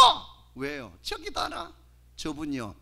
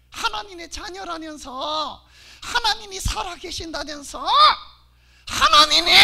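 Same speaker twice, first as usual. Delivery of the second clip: normal talking voice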